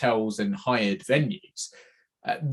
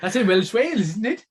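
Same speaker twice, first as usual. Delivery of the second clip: put-on a shaky stab at a Welsh accent, pitched up; attempted Welsh accent; 9 semitones higher than usual. Teasing his co-host about Llandudno, a failed Welsh accent. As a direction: try a Welsh lilt, not quite landing it. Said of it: "neither of us can do the accent".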